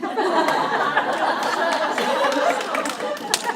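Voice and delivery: loud burst of open laughter; big real laugh. Real, not acted. Owner cracking up over the resident's stroll line, loud laughter.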